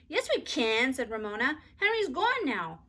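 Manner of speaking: put-on high, loud childish insistence; stubborn child. A narrator's reading. Ramona arguing with Beezus, high and stubborn. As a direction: insist like a bratty little sister.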